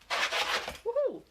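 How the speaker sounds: squeaky high woohoo; little woohoo. Real, not acted. Cheering to the camera about sandpaper, squeaky woohoo.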